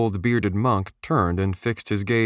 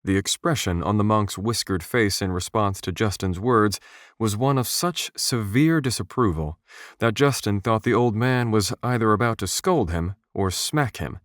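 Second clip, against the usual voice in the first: usual speaking voice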